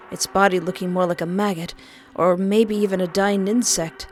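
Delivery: ordinary delivery, nothing marked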